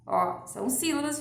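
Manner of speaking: usual speaking voice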